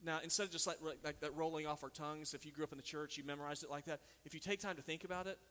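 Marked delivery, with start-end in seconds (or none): none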